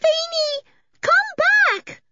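put-on piercingly high child's cry. A narrator's reading. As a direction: call urgently after a runaway pet. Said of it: "cried Eily".

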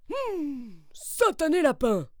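a bear grumbling to himself; lightly voiced grumbling bear. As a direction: mutter it like a cross old bear. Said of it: "maugréa monsieur Ours".

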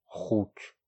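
contained anger, said under the breath; muttered growl. Acted. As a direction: mutter the insult to yourself. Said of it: "پیر زیر لب غرید".

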